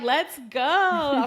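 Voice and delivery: cheerleader hype. Retold or imitated. Mother rallying the delivery team, cheerleader style. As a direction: fire up the room like a cheerleader. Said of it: "A former cheerleader".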